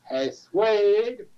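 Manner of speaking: high, loud Houston holler, vowel stretched long; hammed-up Houston greeting; Houston accent. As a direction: call out like a Texas buddy, imitating the Houston accent. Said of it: "with that amazing Houston accent".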